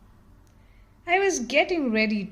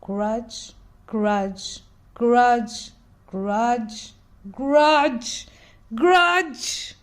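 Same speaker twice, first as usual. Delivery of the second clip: growing grudge; 5 dB louder, much slower than usual. Acted. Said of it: "only one thought came to my mind".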